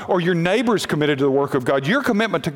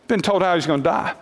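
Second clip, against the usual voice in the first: ordinary talk, nothing marked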